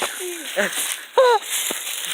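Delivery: far off high giggle; high squeaky laugh. Shown as a demonstration. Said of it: "yeah, exactly".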